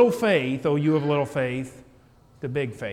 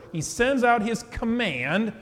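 slow, higher-pitched, weighty "command"; stress on command; 5 semitones higher, slower than usual. Real, not acted. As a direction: repeat it slowly, leaning hard on "command". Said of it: "I want to really emphasize that word".

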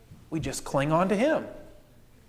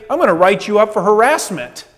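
mock indignant threat; 4 semitones higher, 8 dB louder than usual. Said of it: "Or she doesn't say".

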